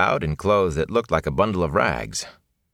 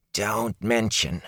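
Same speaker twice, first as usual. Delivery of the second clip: hoarse whisper; 3 semitones higher, 3 dB quieter, slower than usual. A narrator's reading. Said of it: "his voice dropped into a hoarse whisper".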